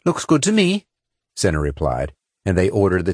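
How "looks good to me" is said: raised, agreeable reply; higher female character voice. A narrator's reading. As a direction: voice the woman agreeing. Senna agrees.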